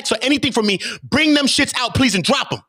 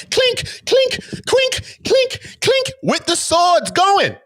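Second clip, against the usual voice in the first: high sword clinks; much slower than usual. Pretend. Sound effect sword clash.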